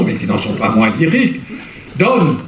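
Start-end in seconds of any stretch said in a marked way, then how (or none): none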